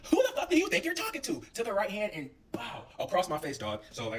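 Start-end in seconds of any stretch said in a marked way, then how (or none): 0.1-1.4 put-on high, loud angry girl voice; mock furious girl. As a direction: imitate her snapping before the slap. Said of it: "She said".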